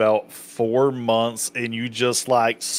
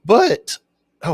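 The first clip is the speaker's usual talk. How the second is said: sudden upward voice break; voice crack; 10 semitones higher, 7 dB louder than usual. Real, not acted. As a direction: voice cracks high on the word. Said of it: "That was a nerd voice crack".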